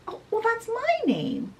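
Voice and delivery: put-on high-pitched childlike surprise; surprised little princess. Princess hears her own name, high surprised voice. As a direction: pipe up high in amazement, as a little girl.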